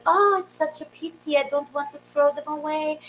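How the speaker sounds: put-on high, soft older Eastern European neighbor; high, quiet accented lament; [name]. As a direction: imitate a regretful Eastern European lady. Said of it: "She talks like that".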